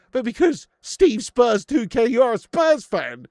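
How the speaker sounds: loud, slow, jeering; mocking loud taunt. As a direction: shout it slowly to rub it in. Taunting Spurs fan in chat.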